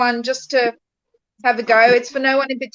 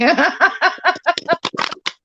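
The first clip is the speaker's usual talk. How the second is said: bright laugh; 7 semitones higher than usual. Real, not acted. Laughs after teasing warning.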